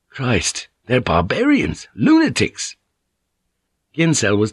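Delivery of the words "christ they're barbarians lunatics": horrified outburst climbing to a high pitch; appalled. Acted. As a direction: cry out in shock, highest on the last word. Ginsel appalled at the beheading.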